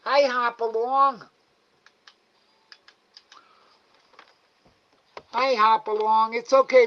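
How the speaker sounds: high, loud sing-song coaxing of an animal; coaxing pet talk. Real, not acted. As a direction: coax the shy raccoon to come and eat. Coaxing a raccoon to eat.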